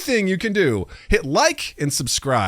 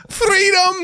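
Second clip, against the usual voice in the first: high-pitched mock Scottish battle cry; drawn-out high Scottish holler; Scottish accent; 4 dB louder than usual. Shown as a demonstration.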